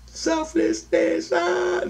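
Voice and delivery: very high, drawn-out sing-song wail; imitated crying. Dreamer retelling Amy's cry on waking, high mock wail. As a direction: mimic someone crying in a stretched, high voice. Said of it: "She's fucking crying".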